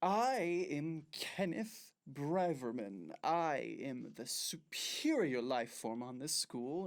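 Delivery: slow, pompous, self-important mock speech; mock villain boast. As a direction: puff up like a school tyrant, drawn out. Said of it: "all right, you ready?".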